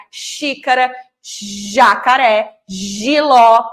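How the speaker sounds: stretched first sounds: sh in "xícara", zh in "jacaré" and "giló"; minimal-pair drill. Shown as a demonstration. Teacher drilling minimal pairs, lengthened first consonants. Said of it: "Trabalhar os pares mínimos".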